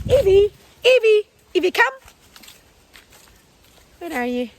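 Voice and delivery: loud high call across the field; calling the dog. Real, not acted. Owner calling her puppy back, loud and high.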